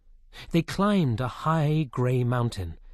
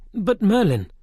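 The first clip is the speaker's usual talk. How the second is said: put-on a higher, louder young king cry; 8 semitones higher, 6 dB louder than usual. A narrator's reading. Arthur crying out to Merlin in protest. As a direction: protest to an old friend. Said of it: "King Arthur cried".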